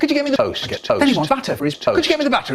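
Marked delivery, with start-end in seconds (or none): 0.0-0.6 dad's request. Retold or imitated. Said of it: "My dad wants toast". 2.0-2.5 dad's request. Retold or imitated. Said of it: "He says".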